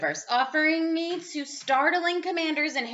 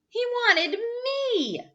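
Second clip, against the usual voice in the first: scared; 11 semitones higher, slower than usual. A narrator's reading. Monster wants the narrator.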